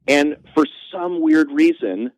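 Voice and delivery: ordinary talk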